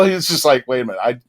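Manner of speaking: ordinary talk, nothing marked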